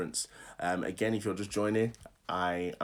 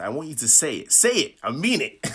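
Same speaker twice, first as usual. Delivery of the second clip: louder, higher, insistent command; aggressive urging; 4 semitones higher, 8 dB louder than usual. Real, not acted. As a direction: press the listeners hard to obey. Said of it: "I don't mean to be so aggressive".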